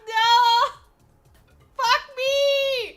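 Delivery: high, loud shouted curses; retelling the broken handle, shouting; despairing yells. Retold or imitated. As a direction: yell in total despair. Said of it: "And I was like".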